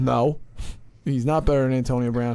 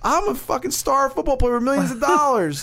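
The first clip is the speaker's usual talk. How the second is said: mock baffled rant; 11 semitones higher than usual. Mocking a reckless rich football player.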